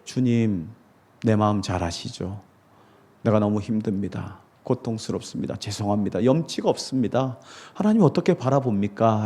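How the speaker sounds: soft, hushed confession to God; quiet prayer. Pretend. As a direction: pray it low and humble. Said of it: "라고 고백하십시오". (하나님께 조용히 고백하듯 낮고 부드럽게)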